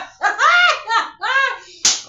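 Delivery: shrieking high laugh; howling laughter at the story; loud cackle. Real, not acted. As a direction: let out a big laugh. Laughing at mom.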